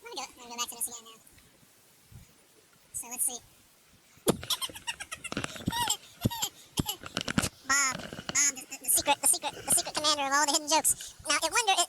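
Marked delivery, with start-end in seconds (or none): 4.2-6.0 shrill fit of laughter, way up high; high squealing laugh. Real, not acted. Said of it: "Laughing so hard I got tears in my eyes".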